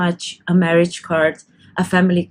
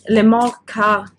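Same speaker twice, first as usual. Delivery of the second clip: card with a French accent, r dropped; slow French-accented slip on "card"; slower than usual. Real, not acted. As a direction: slip into French pronunciation, slowly. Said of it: "pronounced it card with a French accent".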